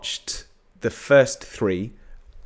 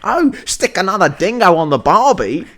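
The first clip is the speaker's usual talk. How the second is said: mock Australian accent; 9 semitones higher, 8 dB louder than usual. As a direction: overdo a cheesy Australian accent. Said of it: "British thing of Australians".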